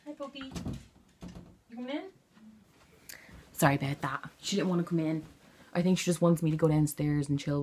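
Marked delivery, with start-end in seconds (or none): 0.1-2.0 high, soft pet-coaxing voice; talking to puppy. Real, not acted. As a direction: coax the puppy in gently, pitched right up. Talking to her dog at the door.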